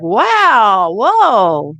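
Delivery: loud, high astonished wow and whoa; retelling a burst of wonder as he leaves his body. Retold or imitated. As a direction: gasp it out in wonder. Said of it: "And I was like".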